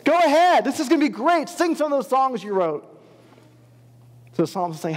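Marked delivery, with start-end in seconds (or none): 0.0-2.8 high, loud jeering taunt with a fake laugh; mocking captors. Captors taunt the weeping exiles, loud high mocking jeer. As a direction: sneer at the grieving exiles like a cruel captor. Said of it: "and they're saying".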